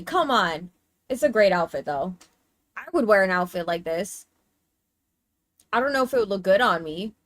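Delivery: normal talking voice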